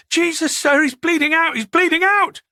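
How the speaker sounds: high, urgent alarm; frantic, high-pitched, rising in force on the repeat; panicked. Acted. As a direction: cry for help to your superior in panic. Officer panics over stabbed victim.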